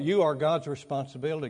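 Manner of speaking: usual speaking voice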